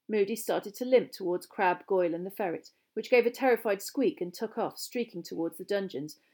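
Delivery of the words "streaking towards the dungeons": ordinary delivery, nothing marked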